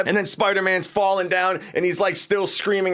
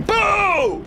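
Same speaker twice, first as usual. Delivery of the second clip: much higher, slow drawn-out boom; big boom. Real, not acted. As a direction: stretch the boom out for effect. Narrating the explosion.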